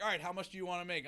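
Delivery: quick, high-pitched mock boss; high fast boss voice.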